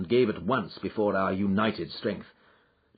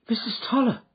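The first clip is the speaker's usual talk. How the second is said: startled call, much higher; surprised cry; 13 semitones higher, faster than usual. Acted. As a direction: cry her name in surprise. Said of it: "cried Miss Hunter".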